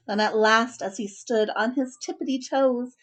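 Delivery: usual speaking voice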